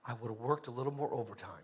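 a hint of a weak voice at the end of life; dying person's wish. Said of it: "somebody on a deathbed saying".

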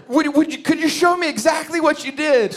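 high, halting question; tiptoeing around her feelings; careful question. Retold or imitated. Husband gently asks his wife how she cooked, high.

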